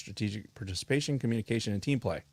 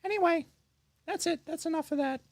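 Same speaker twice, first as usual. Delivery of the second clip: silly put-on lady voice; goofy character voice. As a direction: play a goofy woman character. Holds put-on voice.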